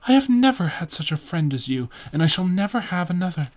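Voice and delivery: high-pitched, urgent protest; distressed cry, pitched well up. A narrator's reading. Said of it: "he cried".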